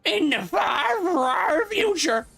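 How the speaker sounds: high, slow monster bellow. Acted. Monster answering about the far future, monster voice. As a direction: stretch each word into a big monster shout. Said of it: "gonna give the monster a monster voice".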